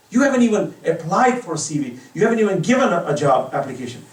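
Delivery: loud, high, insistent; emphatic. Real, not acted. Preacher insisting to listeners, loud and emphatic. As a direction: press the point hard. Preacher stresses CEOs seek you.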